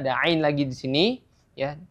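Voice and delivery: ordinary delivery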